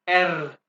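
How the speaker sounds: "एर" loud with a vibrating tongue; trilled R. Shown as a demonstration. Teacher shows pupils German R, trilled "एर". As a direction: roll the R at the end of "एर". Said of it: "यहाँ पे जुबान को vibrate करें".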